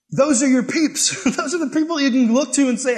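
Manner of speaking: talking through a laugh. Real, not acted. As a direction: laugh while you say it. Laughs with congregation.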